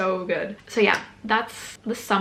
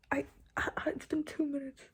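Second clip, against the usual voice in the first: whisper; 4 semitones higher, 10 dB quieter than usual. Real, not acted. Stunned after visa granted.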